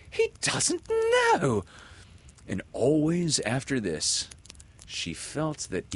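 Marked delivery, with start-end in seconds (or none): 0.1-1.6 very high, slow exclamation of shock. A narrator's reading. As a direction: draw it out in high-pitched disbelief. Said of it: "so shocked that she exclaimed".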